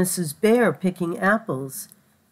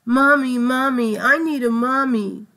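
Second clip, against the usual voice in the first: high, louder wail of Mommy, Mommy; crying for mommy; 5 semitones higher, 4 dB louder than usual. Acted. Lost little bird crying out for its mother. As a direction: cry out for a mommy like a lost child. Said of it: "He started to cry".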